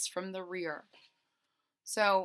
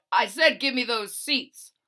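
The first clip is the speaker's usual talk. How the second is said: bellowing; 3 semitones higher, 9 dB louder than usual. Acted. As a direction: bellow it like an angry driver. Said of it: "the bus driver bellowed".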